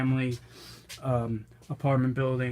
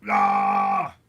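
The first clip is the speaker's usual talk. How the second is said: sharp yelp, higher and louder; brain freeze cry; 6 semitones higher, 5 dB louder than usual. Real, not acted. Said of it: "Ooh, brain freeze".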